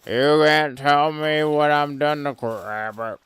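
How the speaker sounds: slurred cartoon drunk, yelling; loud drunken frog rant; drunk with ribbits. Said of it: "the drunk hiccup thing from all the cartoons, but instead of hiccups, it's ribbits".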